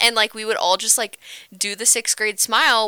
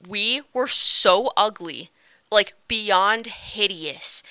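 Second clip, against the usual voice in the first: slow emphasis; much slower than usual. Real, not acted. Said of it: "emphatic slow delivery".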